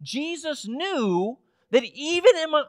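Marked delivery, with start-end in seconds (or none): 1.7-2.6 real voice crack mid-phrase. Real, not acted. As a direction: let the voice break while pushing the point. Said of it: "oh, my voice just cracked there".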